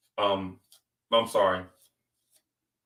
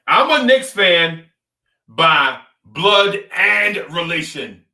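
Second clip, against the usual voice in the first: proud declaration; 5 semitones higher, 8 dB louder, slower than usual. Real, not acted. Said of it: "proud declaration".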